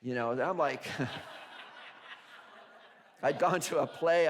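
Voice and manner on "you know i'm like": ordinary talk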